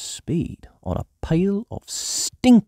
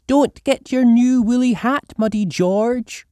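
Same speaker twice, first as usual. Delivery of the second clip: high, louder motherly warning; Mummy Pig voice; 14 semitones higher, 5 dB louder than usual. A narrator's reading. Mother telling George to keep his hat clean, high voice. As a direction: warn him kindly but firmly. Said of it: "says Mummy Pig".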